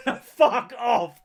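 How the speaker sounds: high, drawn-out incredulous dismissal; slow, high-pitched mock outrage; amused disbelief. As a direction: scoff loudly at an absurd lie. Acting his incredulous reply to lie.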